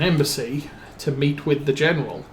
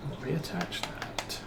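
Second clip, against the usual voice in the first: whispered, much softer; under his breath; 3 semitones higher, 12 dB quieter than usual. Real, not acted. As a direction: repeat it in a whisper to yourself. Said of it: "repeats it under his breath".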